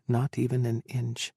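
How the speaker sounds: ordinary delivery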